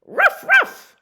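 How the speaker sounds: very high, loud barks; squeaky, loud doggy ruffs. Acted. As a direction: bark like an eager little dog. Dog barking in story.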